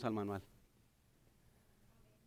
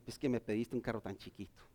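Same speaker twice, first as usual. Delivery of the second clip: suave, más bajo y sonriente; Dios sonriendo; 10 semitones lower, 10 dB quieter than usual. Retold or imitated. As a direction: dilo bajito con una sonrisa. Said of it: "sonriendo diciendo". (Dios sonriendo al creyente, suave y grave)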